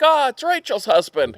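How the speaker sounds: high-pitched mock excited hail; excited greeting. Golf buddies spotting Rachel's husband, excited greeting. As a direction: greet him like a minor celebrity.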